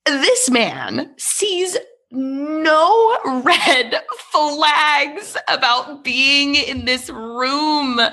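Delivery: high, drawn-out word-by-word disbelief; incredulous emphasis. Real, not acted. Host marvels at a victim missing every red flag, incredulous. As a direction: stretch every word out, pitched high in exasperation. Exasperated comic remark about horror victim.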